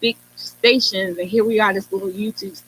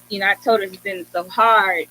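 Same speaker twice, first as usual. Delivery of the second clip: normal talking voice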